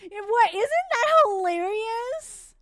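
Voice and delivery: high, loud, slow mock disbelief; exasperated sarcastic outburst. Real, not acted. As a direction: drip sarcasm over the bad luck. Sarcastic about Sebek arriving.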